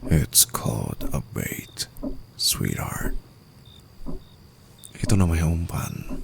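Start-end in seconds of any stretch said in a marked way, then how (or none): 0.1-3.2 slow, soft and low whisper; a sinister low murmur; slow menacing purr. Acted. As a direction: savour the reveal. Revealing the trap.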